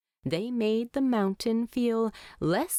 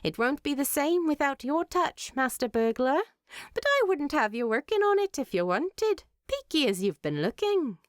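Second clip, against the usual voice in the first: Freya; 7 semitones higher, faster than usual. A narrator's reading. Freya voiced in story dialogue.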